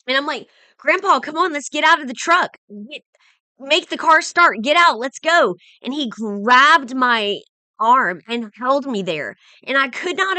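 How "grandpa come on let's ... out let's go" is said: higher, louder retold urgent plea; urgent pleading. Granddaughter begging grandpa to leave the truck, urgent pleading. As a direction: urge him out, voice pitched up.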